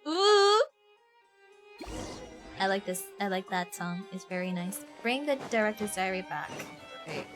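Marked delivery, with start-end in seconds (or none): none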